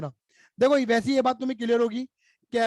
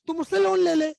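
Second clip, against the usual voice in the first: insistent offer; 6 semitones higher, 3 dB louder than usual. Pretend. Partner insists on lending.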